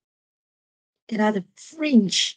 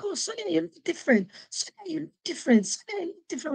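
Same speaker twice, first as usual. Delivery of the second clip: higher, quick teasing colleagues' voice; imitating colleagues; 7 semitones higher, 3 dB quieter, faster than usual. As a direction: mimic the colleagues, pitch up and hurried. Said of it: "They were like".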